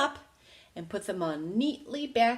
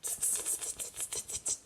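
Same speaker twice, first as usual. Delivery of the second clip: very soft unvoiced cheeky clicking; hushed tsk tsk; 13 dB quieter than usual. A narrator's reading. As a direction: monkeys mocking him in a tiny whisper. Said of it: "and said".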